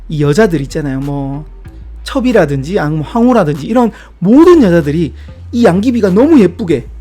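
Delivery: normal talking voice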